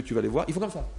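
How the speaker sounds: ordinary delivery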